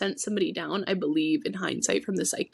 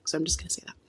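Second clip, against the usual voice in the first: whispered aside; 2 semitones lower, 5 dB quieter, much faster than usual. Real, not acted. Conspiratorial blunt aside.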